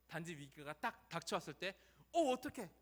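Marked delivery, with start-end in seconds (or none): 2.1-2.7 high, loud mock alarm; flustered. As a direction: act caught off guard, scrambling. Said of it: "이런 느낌이". (당황한 듯)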